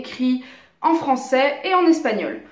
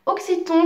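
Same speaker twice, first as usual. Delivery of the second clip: "Occiton" set apart, higher; "Occiton" lifted higher to introduce it; highlighting a term; 6 semitones higher than usual. Shown as a demonstration. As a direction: present "Occiton" as a key word. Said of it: "on pourrait appeler ça les mots occitons".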